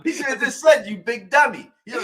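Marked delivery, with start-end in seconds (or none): 0.9-1.7 louder, higher mock Fred Sanford; Sanford and Son insult. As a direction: call him a big dummy Sanford style. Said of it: "Sanford and Son thing".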